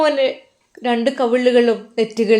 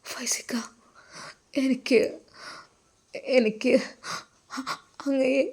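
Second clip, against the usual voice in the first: soft, halting, much softer; hushed confession; 10 dB quieter than usual. Acted. Wife shyly confessing love to husband, hushed. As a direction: hesitate and hush as if afraid to say it.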